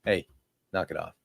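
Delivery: low, soft stern parent warning. Retold or imitated. Hushing a stranger's tantruming kid, low and soft. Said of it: "you're like".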